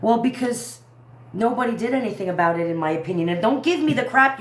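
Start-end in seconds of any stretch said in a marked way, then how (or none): none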